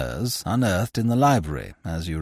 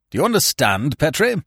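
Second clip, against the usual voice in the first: cried out; 6 semitones higher, 4 dB louder than usual. A narrator's reading. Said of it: "cried Sir Lionel".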